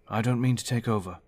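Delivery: a steady officer taking charge, projecting to the room; David, firm team leader. Acted. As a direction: lay out the plan like a confident commander.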